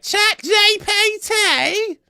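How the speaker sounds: piercing, drawn-out cartoon Australian accent; mock Australian accent. Host imitates locals baffling the returnee, caricatured Australian accent. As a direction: stretch each syllable high and loud in mock Australian. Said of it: "everyone was like".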